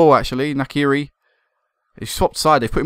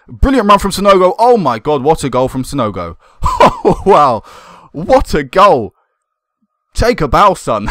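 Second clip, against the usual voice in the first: excited goal call; 3 semitones higher, 6 dB louder than usual. Real, not acted. Manager cheering his striker's goal, excited. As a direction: celebrate the goal out loud. Team scores a great goal.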